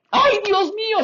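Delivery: high, loud astonished exclamation; stunned cry. Retold or imitated. Pastor meets the pastora, she gasps. (exclamación de asombro, aguda y fuerte)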